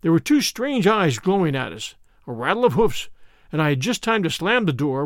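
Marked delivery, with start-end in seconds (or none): none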